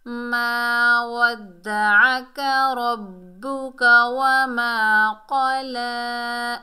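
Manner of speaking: slow, drawn-out melodic chant; Quran recitation. Shown as a demonstration. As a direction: recite the verse slowly with long held vowels. Teacher recites Quran verse.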